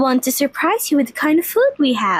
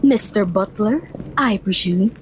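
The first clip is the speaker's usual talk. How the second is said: a lower, stiff imitation of the formal maid; playfully mimicking the prim servant, lower; imitating Lilith; 6 semitones lower than usual. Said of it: "Did I sound like her?".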